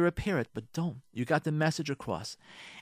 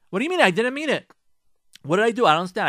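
defensive husband; 8 semitones higher, 9 dB louder, much faster than usual. Pretend. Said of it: "husband defends himself".

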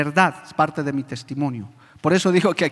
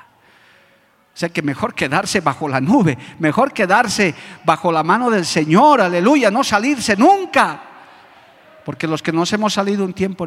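ordinary talk, nothing marked

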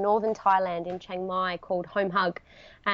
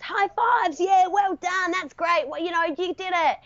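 retold cheering; 9 semitones higher than usual. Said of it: "when you ride into the water stops".

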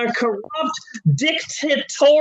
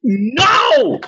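loud, higher mock cry; mock outraged no; 6 semitones higher, 4 dB louder than usual. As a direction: howl it in fake despair. Outraged refusal.

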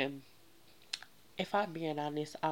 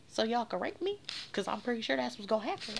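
incredulous, pitched way up; high-pitched indignation; 10 semitones higher, 3 dB louder than usual. Retold or imitated. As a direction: throw it back in a high, alarmed-sounding retort. Indignant retort to men following.

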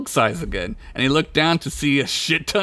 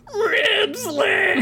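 high anguished wail; crying shout. Acted. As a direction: sob out the name.

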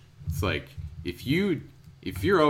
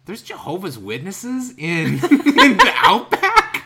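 high, laughing disbelief, slowing down; incredulous disbelief; 10 semitones higher, 10 dB louder, slower than usual. Retold or imitated. Hearing of Jehovah's Witnesses in the outback, laughing disbelief. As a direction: laugh out the shock, pitch up. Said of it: "I was just like".

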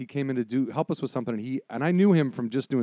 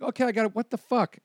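imitated high-pitched double take; startled casting agent; 8 semitones higher than usual. Casting woman spotting a bald actor, startled. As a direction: break off in mock disbelief.

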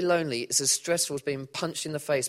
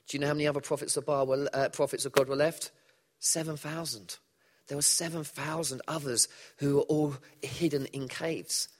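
ordinary talk

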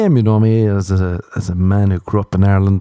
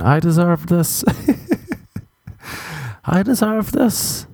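high, slow mock chant; lightly voiced girl repeating to herself; imitating the girl; 8 semitones higher, 3 dB quieter, slower than usual. The girl in bed repeating it, in a high, slow mock chant. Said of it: "she just kept saying".

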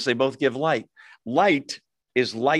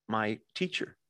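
quiet and hurt; 4 semitones lower, 10 dB quieter than usual. Retold or imitated. Heartbroken girl answers parents.

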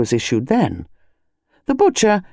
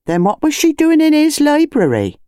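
much higher, louder pointed question; nosy wife; 12 semitones higher, 3 dB louder than usual. Acted. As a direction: press him for gossip. Wife voiced in story.

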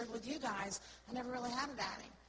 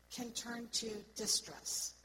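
normal talking voice

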